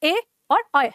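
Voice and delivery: pure ए, then अ said as an ai glide; contrasting e and ai. Shown as a demonstration. Teacher asks for attention, ए pure and अ as ai. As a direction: separate ए clearly from अ, said as ai. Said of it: "इनका उच्चारण फिर से ध्यान दो".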